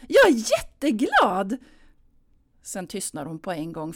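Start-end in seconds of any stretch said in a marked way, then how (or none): 0.1-1.6 higher, louder, forced gladness; false cheer. Acted. Anna faking joy for her mother: forced loud cheer. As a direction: overdo the happiness so it rings false. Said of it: "det lät så falskt".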